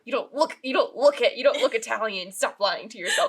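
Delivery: loud, emphatic imitation of an insistent woman; insistent accusation. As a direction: press the mock accusation hard. Acts out woman insisting she's Slavic.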